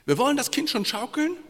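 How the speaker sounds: high, loud, fast boast; mock confident. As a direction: spielt den Großspurigen. Preacher mocks self-reliance. (spöttisch großspurig, hoch und laut)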